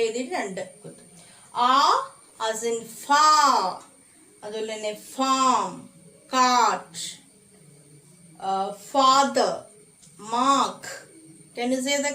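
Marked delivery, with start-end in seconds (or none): 1.7-3.8 "far" with a very long aa; long vowel. Shown as a demonstration. Teacher showing the long vowel, "far" drawn right out. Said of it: "long vowel". 6.3-10.8 "cart", "father", "mark" with held aa; long vowel. Shown as a demonstration. Teacher giving examples of the long sound, aa held slowly. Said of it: "അപ്പൊ ആ എന്നുള്ള sound".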